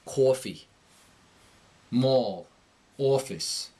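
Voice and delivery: thick "or" vowel in "Coffee. Mall. Office", New York accent; New York accent, punch a thickened "aw" in "Coffee". Shown as a demonstration. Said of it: "That's the or sound thickening".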